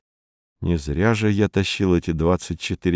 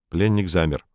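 ordinary delivery

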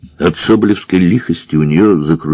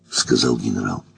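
usual speaking voice

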